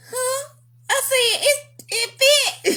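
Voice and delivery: high, slow and bashful; innocent squeak. Retold or imitated. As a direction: play dumb in a tiny high voice. Innocent confused reply.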